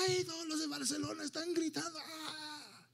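high, thin imitation stadium roar; squealed goal celebration, held back in volume; mock crowd scream. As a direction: wail like distant cheering fans. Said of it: "y todos gritan".

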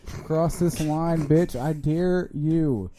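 very slow drawn-out dare. Pretend. Daring an intruder to cross, very slow. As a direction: drag out the challenge.

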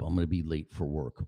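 ordinary talk

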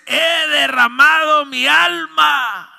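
loud, high, drawn-out shout; slow declamatory cry; impassioned cry. Retold or imitated. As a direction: pour it out at full voice. Preacher acts out Ana's outpouring.

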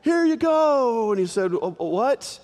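high, bright offering; eager son. Retold or imitated. Esau bringing the meal to his father, high and eager. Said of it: "brings it to his dad".

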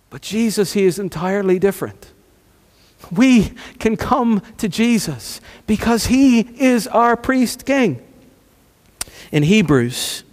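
ordinary talk

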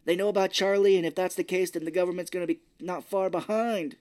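a panicked parent who knows the cover's blown; mock argument. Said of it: "they're yelling".

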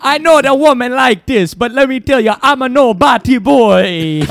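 put-on Jamaican accent. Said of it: "we do great Jamaican accents".

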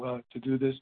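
ordinary talk

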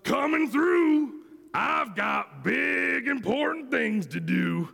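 high, self-important call; yelling dump truck. A narrator's reading. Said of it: "yelled a dump truck".

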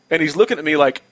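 usual speaking voice

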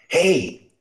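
loud high call across the road. Acted. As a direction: hail him from the doorway. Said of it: "he called from the doorway".

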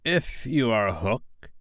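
slow, soft, tentative; ingratiating, hushed appeal; almost humble. A narrator's reading. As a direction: approach carefully, slowly and quietly. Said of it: "he said almost humbly".

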